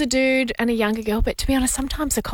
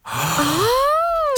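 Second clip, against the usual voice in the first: a sharp gasp, then a high drawn-out oh; gasp of surprise; 12 semitones higher than usual. Real, not acted. Co-host reacting to the caller's story with a high gasp. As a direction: caught off guard, gasp and squeal the oh. Hears officer slipped number in bag.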